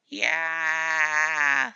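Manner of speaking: loud, held yelling cat; cat scream. A narrator's reading. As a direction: scream to terrify.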